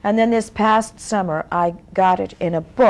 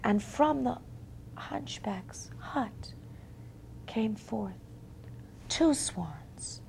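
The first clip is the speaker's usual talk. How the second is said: soft, hushed, whispery close of a tale; quiet fairy-tale ending, softly fading; hushed storytelling; 3 semitones lower, 13 dB quieter than usual. A narrator's reading. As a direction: draw the listeners in with a near-whisper.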